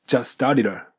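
"started a" run together as one word, the t flapped; teacher shows "started a" with the t flapped into the a; linked started a. Shown as a demonstration. Teacher demonstrating the linking to students, flapped and linked. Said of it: "こんな感じ".

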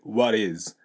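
"what is" said as "wadiz", the T softened to D; soft D link. Shown as a demonstration. Teacher shows the linked sound, soft D. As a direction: flap the T into "is". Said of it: "TとisがくっついてsoftとDに変わって".

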